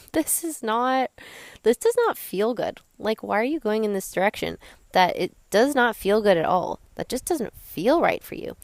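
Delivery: scolding inner voice, pitch lifted; body's protesting voice. Retold or imitated. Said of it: "signals from my body that would be saying".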